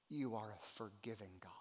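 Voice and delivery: low, reverent whisper; hushed awe; whispered prayer. Acted. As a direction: pray it under your breath.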